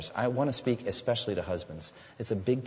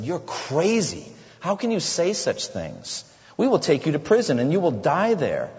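angry threat; 7 semitones higher, 6 dB louder than usual. Acted. Officer threatens arrested pastor.

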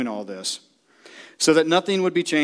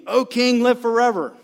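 Daniel calling up; 7 semitones higher than usual. Acted. Daniel answers king from den.

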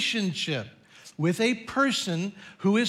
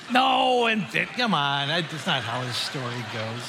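laughing protest; 5 semitones lower than usual. Real, not acted. Preacher takes back a shock ending, laughing protest. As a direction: wave off your own joke with a laugh.

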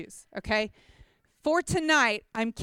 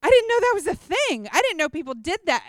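higher and louder baffled disbelief; child hurt by the neighbors' jeering, surprised; stunned surprise; 6 semitones higher, 5 dB louder than usual. Retold or imitated. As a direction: react as a shocked child. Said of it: "I was like".

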